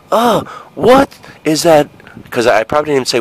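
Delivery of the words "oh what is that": put-on high, slow puzzled kid voice. Six-year-old asking about the smell, high and slow. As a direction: sound like a six-year-old sniffing the air. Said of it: "in my kid voice".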